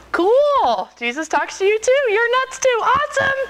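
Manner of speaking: high-pitched gushing relief; mock delighted. Acts out her relieved, delighted reply.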